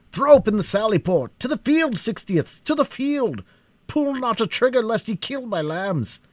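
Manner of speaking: high, loud, overwhelmed father's cries; a father crying out in relief and fear; father ordering his men out to save his daughters; parental agony. A narrator's reading. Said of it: "in the awful tones of parental agony".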